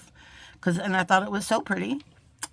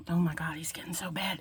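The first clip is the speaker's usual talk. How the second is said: exasperated whisper about the cat; a whispered aside in exasperation; 4 semitones lower, 9 dB quieter than usual. Real, not acted. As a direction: hush it, half to yourself.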